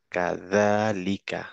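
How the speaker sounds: কাযালিকা with a voiced th sound on যা; careful model reading; Arabic reading. Shown as a demonstration. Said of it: "উচ্চারণ হচ্ছে দা".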